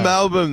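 Melbourne said as MAL-bn, like an Australian; "Melbourne" with a swallowed ending; Australian pronunciation. Acted. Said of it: "with an Australian accent".

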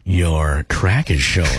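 very low, slow seductive purr; flirty. Pretend. Co-host goes first trying to sound hot, flirty. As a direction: flirt with it, deep and lingering. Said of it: "Say it flirty".

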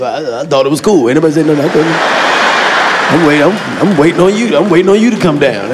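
low, loud sheepish stammer; dumb excuse voice. Acted. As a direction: mumble a lame excuse. Acted dumb boyfriend excuse.

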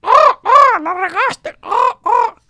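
very high, loud parrot screech; squawking parrot. Acted. The parrot scolds the grandfather, very high loud squawk.

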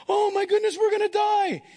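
high-pitched, hammed-up panic; mock freak-out. Assistant seeing the enemy army surrounding them, freaking out in mock panic. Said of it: "his assistant's freaking out".

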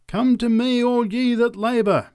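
high, loud summons to the faithful; preacher's cry. A narrator's reading. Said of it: "cried the preacher".

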